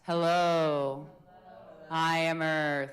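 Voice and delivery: loud, grounded chest voice; authoritative earth voice. Shown as a demonstration. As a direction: resonate from the chest, firm and steady. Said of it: "reverberation in your chest".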